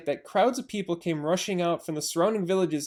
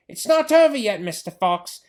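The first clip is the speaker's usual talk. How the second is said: higher, louder gloating threat; menacing shout; 6 semitones higher, 6 dB louder than usual. Acted. Bean shouting down the hole at the fox, menacing.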